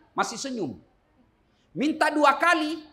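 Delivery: usual speaking voice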